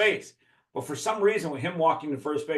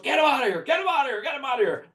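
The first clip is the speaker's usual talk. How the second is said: high-pitched mock yell of the angry coach; 9 semitones higher, faster than usual. Retelling the other team's coach yelling at the umpire, high mock yell. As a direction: squeal it like the furious opposing coach.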